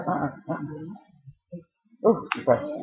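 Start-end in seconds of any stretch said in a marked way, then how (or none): none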